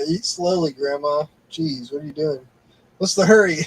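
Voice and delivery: mock scolding. Mock scolding grandma for eating fast.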